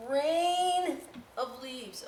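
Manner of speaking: playful sing-song; drawn out. Shown as a demonstration.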